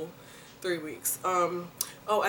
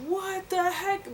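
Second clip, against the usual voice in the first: much higher, slow shocked squeal; 13 semitones higher, slower than usual. Retold or imitated. Woman recalling heartburn at night, shocked high squeal. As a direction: squeal it in disbelief. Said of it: "I was like".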